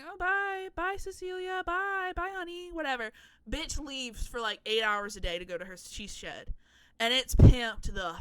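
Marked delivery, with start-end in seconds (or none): 0.0-2.7 high sing-song goodbye; bright fake-sweet farewell to the wife; chirpy high goodbye; waving bye. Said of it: "she's like".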